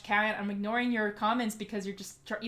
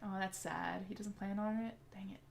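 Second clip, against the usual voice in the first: a disappointed unvoiced whisper; 9 dB quieter than usual. Real, not acted. Streamer reacting to chat's sad news, disappointed whisper. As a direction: breathe it out, let down.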